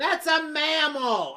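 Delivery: indignant. Acted.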